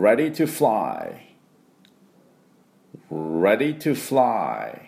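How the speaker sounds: "Ready to fly" slowly, R and "fly" drawn out; repeat-after-me model. Shown as a demonstration. Teacher leading pronunciation practice, slow English model. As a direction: model "Ready to fly" for learners to copy, long "ai". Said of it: "私の後について発音いきましょう".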